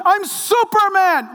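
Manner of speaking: high, slow, proud little boy playing Superman; kid hero voice. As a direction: announce it like a six-year-old in a cape. Child pretending to be Superman.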